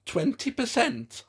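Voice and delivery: high-pitched puppet offer; Hedwig. A narrator's reading. Hedwig puppet offers Hans a discount, saucy haggling. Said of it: "offered Hedwig".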